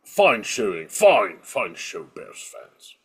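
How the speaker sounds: low mock posh British; British accent. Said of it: "bad British accents".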